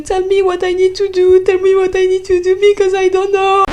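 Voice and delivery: high, frantic plea close to tears; breaking down in front of the officer, high and desperate; panicked English plea. Retold or imitated. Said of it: "En anglais, ça donnait".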